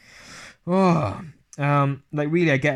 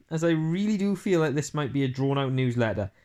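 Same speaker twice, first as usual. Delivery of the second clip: ordinary talk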